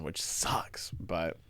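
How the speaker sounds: breathy whisper under the breath; a quick whispered grumble; whispered aside. Real, not acted. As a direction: drop to a whisper for the gripe. Aside about rewards going down.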